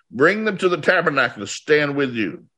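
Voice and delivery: ordinary delivery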